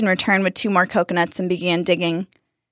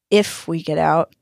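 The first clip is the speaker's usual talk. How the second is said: doubtful repeat; 2 semitones lower than usual. Acted. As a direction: echo it with grim doubt. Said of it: "Max repeated".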